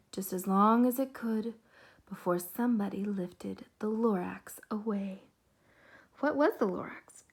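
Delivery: rhythmic storybook reading, hushed then swelling; story narration. A narrator's reading. Reading The Lorax to a group of kids. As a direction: read it like a bedtime rhyme to kids.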